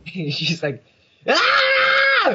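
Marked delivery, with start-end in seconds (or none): 1.3-2.4 very high mock tantrum scream; imitated sister's furious screech; long piercing "aah"; angry shriek. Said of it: "She gets so angry".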